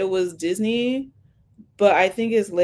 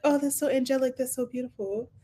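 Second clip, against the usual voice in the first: higher, softer mock swoon over the song; mock gushing; 5 semitones higher, 5 dB quieter, faster than usual. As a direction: gush over it with fake awe. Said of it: "go with it, like".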